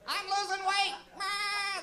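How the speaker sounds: high squeaky mock mayor; mock Rob Ford. Mocking the mayor claiming he is losing weight, high squeaky voice. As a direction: squeak it out like a cartoon. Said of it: "that's what I hear whenever he fucking talks".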